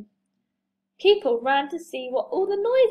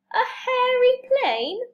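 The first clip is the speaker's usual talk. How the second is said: high, drawn-out rising question; slow, high-pitched query; incredulous question; 11 semitones higher, 4 dB louder than usual. Acted. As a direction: echo it back in surprised disbelief. Amused disbelief at the name.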